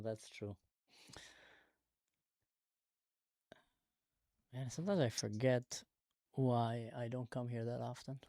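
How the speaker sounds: soft, low aside to viewers; quiet aside. Real, not acted. Streamer mutters to his viewers after the fight, quiet aside. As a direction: drop the voice, confide to the audience. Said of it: "aside to viewers after argument".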